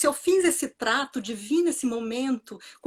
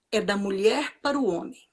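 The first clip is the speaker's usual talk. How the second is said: usual speaking voice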